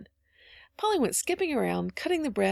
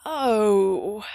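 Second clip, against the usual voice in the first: long, loud sighing "oh"; 7 dB louder than usual. A narrator's reading. Little Davy longing for the candles, sighing. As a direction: draw it out like a sigh. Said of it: "drawing a long sigh".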